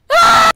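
sudden very high shriek; one loud scream of fright; startled scream. Real, not acted. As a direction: shriek on a jump scare. Another scare in the game.

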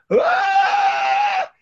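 piercing high stage war cry; huge mock battle shout. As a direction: yell it like charging on stage with spears. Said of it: "and I go".

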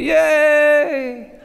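squealed high, loud yay; mock cheer. Dad cheering his daughter's team win, squealed high and loud. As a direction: cheer like a thrilled fan. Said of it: "And I was like".

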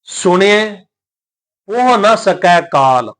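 slow, measured recitation of sacred verse; scripture recitation. Shown as a demonstration. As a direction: recite each word drawn out, with pauses.

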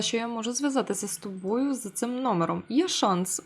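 ordinary talk

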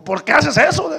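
high, loud demanding why. Retold or imitated. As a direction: confront him, irritated. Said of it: "estaba enojado de veras". (pregunta enojada, aguda y fuerte)

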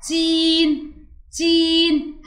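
"تين" with a high, held "ee"; long ee vowel. Shown as a demonstration. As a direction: stretch the middle of "تين". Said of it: "مد بالياء". (مدّ الياء في "تين")

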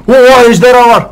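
loud, high thrilled outcry; loud amazed whoop; excited exclamation. Real, not acted. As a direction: shout it at the sudden sight. Spots a dragon.

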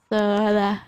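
normal talking voice

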